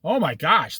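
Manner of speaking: ordinary talk, nothing marked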